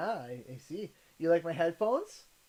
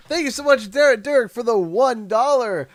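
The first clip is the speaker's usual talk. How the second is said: loud thanks; 5 semitones higher, 7 dB louder than usual. Pretend. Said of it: "my infamous dono steez".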